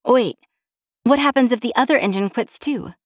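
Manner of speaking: high-pitched, worried question; alarmed. A narrator's reading. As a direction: suddenly realize the danger. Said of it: "no small amount of alarm in her tone".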